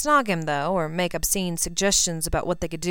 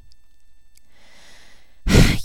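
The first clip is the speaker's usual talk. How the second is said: heavy sigh; 4 dB louder than usual. A narrator's reading. Exasperated before answering.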